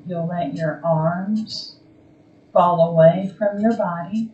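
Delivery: slow, calm meditation voice with long pauses; guided meditation. Acted. Yoga teacher guiding a resting class into Yoga Nidra, calm guided meditation. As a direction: ease them gently toward stillness, phrase by phrase.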